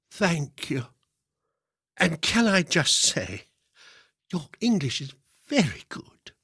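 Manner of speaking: high-pitched lady complimenting a foreigner; high, careful compliment to a non-native speaker; lady praising foreigner. A narrator's reading. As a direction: pitch it up as the well-meaning lady. Lady praises the supposed foreigner's English.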